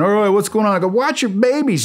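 high-pitched, urgent raid chatter; urgent calling out. Retold or imitated. Soldier warning his boss in a raid, urgent high call. Acted shouts during house raid.

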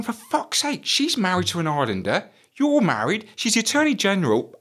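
high, exasperated scolding; exasperated telling-off. Retold or imitated. Officer telling off his lovesick boss, exasperated and high. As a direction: scold him in disbelief.

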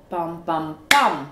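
"pam, pam, PAM", last beat hit loud; two light beats, then hammer the final pam; stress on third beat. Shown as a demonstration. Teacher showing three-syllable rhythm, "pam, pam, PAM" stressed last. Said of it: "se crée un rythme différent".

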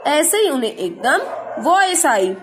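usual speaking voice

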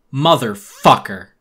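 loud, drawn-out swear; a loud, slow cry of fury; furious curse. Acted. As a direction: let the rage burst out. Furious outburst at the laborers.

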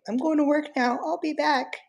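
squeaky parrot copy, pitched high; imitating the parrot. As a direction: parrot impression of his goodbye. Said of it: "he'll say".

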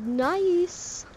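sudden high cheer; excited. Real, not acted. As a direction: pop up in delight. Reacting to double headshot.

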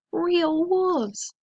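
high, drawn-out cry of alarm. A narrator's reading. Moika frozen before real wolves, alarmed high cry. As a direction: stretch it high in storybook fright. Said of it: "he cried".

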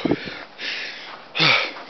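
long breathy sigh of exhaustion; tired sigh. Real, not acted. A tired sigh in the cold after shoveling snow. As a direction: let out a worn-out sigh.

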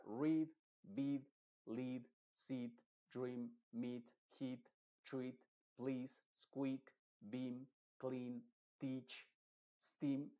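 each word read slowly with a held ee vowel; long ee examples. Shown as a demonstration. Teacher modelling EA words: careful long ee. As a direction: model every word clearly for the class. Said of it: "La E escrita, pero I pronunciada".

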